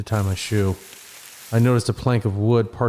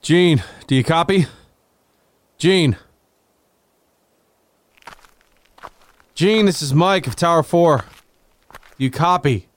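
loud radio call, pitched up; calling over walkie talkie; 7 semitones higher, 4 dB louder than usual. Acted. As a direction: call out into the handset.

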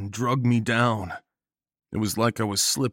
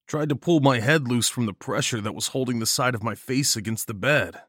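usual speaking voice

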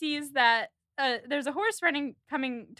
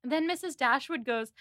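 ordinary delivery

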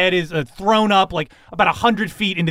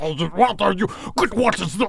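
higher, choked, garbled character voice; garbled outburst; 4 semitones higher than usual. Acted. Mended burger-headed woman splutters, garbled voice. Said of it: "and she goes".

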